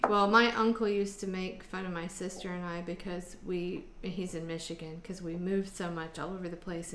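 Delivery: ordinary talk, nothing marked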